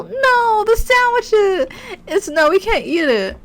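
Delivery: high, loud, drawn-out lament; dismayed wail. Real, not acted. Gamer mourning a sandwich knocked on the table, wailing. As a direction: wail in over-the-top dismay.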